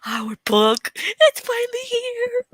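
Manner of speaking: high, drawn-out excited squeal; perky reenactment, soaring at the end. Retold or imitated. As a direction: squeal it slowly, acting out your own excitement. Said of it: "What's got you so perky".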